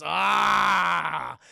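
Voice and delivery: long loud open "aah"; mock-horror drawn-out cry; dramatic cry. Storyteller cries out as the vampire crosses the threshold, dramatic.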